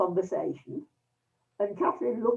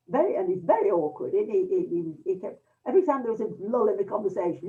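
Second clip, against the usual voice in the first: ordinary delivery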